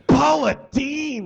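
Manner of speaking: high, loud, drawn-out angry roar. A narrator's reading. Angry celebrity chef to his fellow chef, roared. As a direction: bellow the name slowly. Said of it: "he roared".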